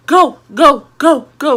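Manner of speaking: high, loud urgent shouts; retold scream. Kids screaming at dad to drive off, frantic. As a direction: imitate frantic yelling to get moving. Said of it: "We screamed at my dad".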